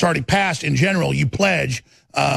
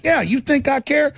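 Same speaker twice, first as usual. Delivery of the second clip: defiant retort; 8 semitones higher than usual. Retold or imitated. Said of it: "I'm like".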